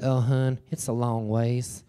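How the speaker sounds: slow, lightly done Southern drawl. Retold or imitated. Descending Southerners warning tired hikers, Southern drawl. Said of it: "they're in the South".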